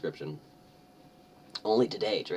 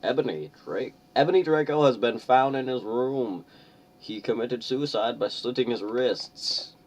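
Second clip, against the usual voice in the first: grave announcement; 5 semitones higher, 5 dB louder than usual. Acted. Voicing Dumbledore announcing death.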